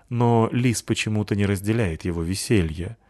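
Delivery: ordinary delivery, nothing marked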